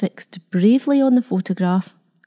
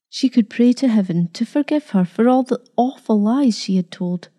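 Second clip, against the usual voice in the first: ordinary delivery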